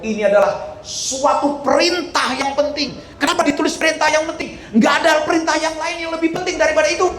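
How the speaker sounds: high, insistent pulpit voice; urgent sermon climax, pitched very high; impassioned preaching. Real, not acted. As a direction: drive the commandment home with soaring pitch. Preacher drives home a commandment.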